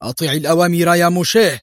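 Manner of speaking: high, loud angry command; angry shout. A narrator's reading. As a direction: bark the order furiously. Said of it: "صاح ديفيد في وجهه محتدا غاضبا".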